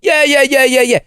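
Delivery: high, chirpy run of yeahs; mock phone chatter. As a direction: parody someone yakking on the phone. Mimics phone chatter on train.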